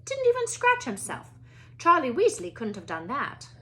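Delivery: clipped headmistress asking politely; a stern older Scottish witch at a classroom door; McGonagall voice. Acted.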